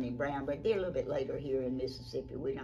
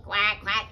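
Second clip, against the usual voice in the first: loud, higher quacks; duck quacking; 5 semitones higher, 12 dB louder than usual. Acted. Storyteller introduces Ducky Lucky, quacking. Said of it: "Ducky Lucky".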